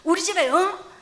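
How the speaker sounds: high, loud mock yell of a scolding dad; imitated angry shouting. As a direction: holler it like a father laying down the law, hammed up. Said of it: "그러면서 막 소리 지르더만".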